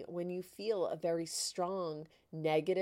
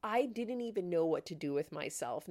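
ordinary delivery

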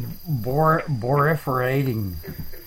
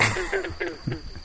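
high laugh; 9 dB quieter than usual. Real, not acted. Laughs at own joke.

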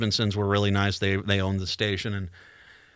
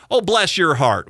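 a light Southern "Oh, bless your heart", higher and louder; sweet Southern lady saying "Oh, bless your heart"; Southern drawl; 5 semitones higher, 4 dB louder than usual. Retold or imitated. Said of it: "the Southern".

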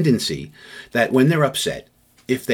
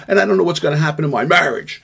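worked-up venting; 6 semitones higher, 4 dB louder, faster than usual. Acted. Upset person venting about marriage.

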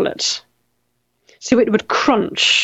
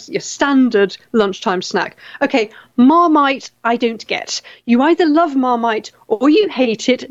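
ordinary delivery

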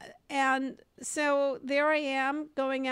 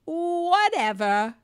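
slow, higher dismissive drawl; 5 semitones higher, 4 dB louder, slower than usual. Retold or imitated. Mocking young men brushing her off, slow dismissive drawl. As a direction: brush her off like she doesn't matter. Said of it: "this got me".

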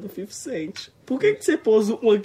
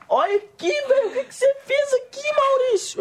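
much higher pitched, a near-crying wail; shocked outcry. Real, not acted. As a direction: shriek at what he did to your hair. Sees his ruined hair.

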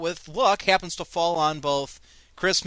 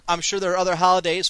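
ordinary talk

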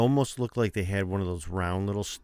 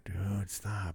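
whisper; 7 dB quieter than usual. Real, not acted. Hushing co-host mid-recording.

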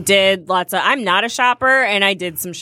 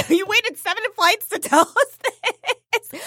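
high, slow, laughing disbelief; incredulous laughing disbelief; 13 semitones higher, slower than usual. Retold or imitated. To the friend who mentioned asthma at the top, laughing disbelief. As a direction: act it like you can't believe she waited this long. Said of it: "I'm like".

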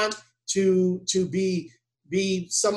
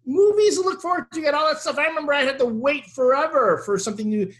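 exasperated rant; 8 semitones higher than usual. Real, not acted. Exasperated rant at ungrateful fans.

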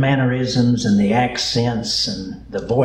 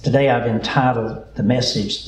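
normal talking voice

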